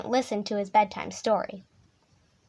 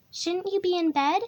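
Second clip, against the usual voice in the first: lightly voiced, higher bush baby; bush baby asking; 6 semitones higher than usual. A narrator's reading. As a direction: ask in a slightly childlike higher voice. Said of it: "she asked".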